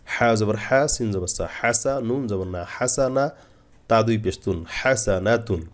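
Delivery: হাসানাতুন built up syllable by syllable, pausing on each join; measured spelling, drawing out হাসা and হাসানা before হাসানাতুন; step-by-step spelling. Shown as a demonstration. Teacher shows the correct method, joined-up spelling of হাসানাতুন. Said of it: "এভাবে বানান করবেন".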